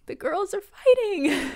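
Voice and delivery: high, slow sing-song delight; excited. Retold or imitated. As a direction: announce the drama with glee. Gossipy aside about fight.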